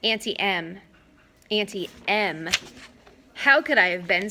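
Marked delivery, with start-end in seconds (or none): none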